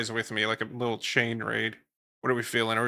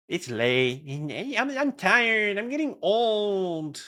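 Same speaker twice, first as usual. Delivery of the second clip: high, drawn-out whine; mock whining; 9 semitones higher, much slower than usual. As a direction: whine about being worn out. Mock complaint about being tired, old.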